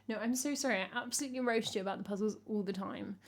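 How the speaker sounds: normal talking voice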